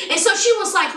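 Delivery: usual speaking voice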